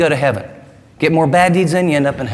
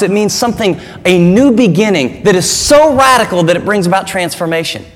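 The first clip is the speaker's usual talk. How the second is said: ordinary talk, nothing marked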